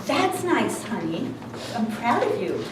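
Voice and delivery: understated, low-key praise dropping away; lightly voiced unimpressed husband; mildly indifferent praise. Husband answering wife's excited news, mildly indifferent praise.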